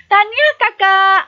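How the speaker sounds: high, bright cheer for the sister; proud excited congratulations. Shown as a demonstration. Sibling congratulating the sister who won, excited. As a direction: congratulate her like you're thrilled. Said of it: "teruja ataupun berasa bangga".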